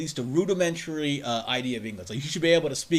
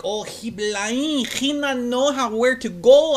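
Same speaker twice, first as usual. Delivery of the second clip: slow, higher mock foreign accent; mock broken English; 8 semitones higher, 6 dB louder, much slower than usual. Guessing what the driver thought, slow mock foreign accent. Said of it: "he could have been like".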